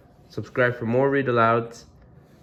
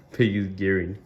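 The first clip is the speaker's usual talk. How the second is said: plain reading voice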